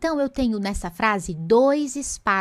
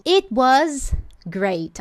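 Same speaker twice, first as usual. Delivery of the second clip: It was great, very slow, raised and louder; enthusiastic great; 3 semitones higher, 4 dB louder, much slower than usual. Shown as a demonstration. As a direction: stretch "It was great" with feeling. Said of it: "Ela foi special".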